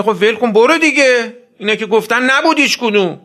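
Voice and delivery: loud, high brush-off; mocking enemy shouting go away; dismissive shout. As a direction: shout them away impatiently. Dismissive enemy voiced in sermon.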